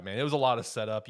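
ordinary talk

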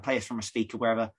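ordinary talk, nothing marked

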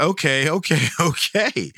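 retold giggle-box reply, laughing; giggling. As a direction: laugh while trying to agree. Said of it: "And I started laughing at".

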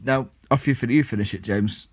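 ordinary talk

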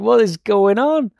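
a retold, amazed what-is-happening; baffled cops. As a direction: imitate the cops' stunned disbelief. Cops baffled by breathalyzer reading.

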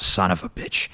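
lightly imitated raspy, throaty wrestler; retold curse with a hint of throaty rasp; Luna. Wrestler blanks and turns on her opponent, lightly imitated rasp. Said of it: "Luna has this raspy, throaty voice".

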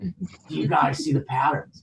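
very soft, awed mock question; barely-there spaced-out murmur; hushed trance. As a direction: imitate a tripping friend lost in the wall. Same question, still staring at wall.

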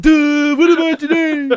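mock confused duh voice; dopey mock voice. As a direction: play a dim, confused commuter. Mocking the confused commuter.

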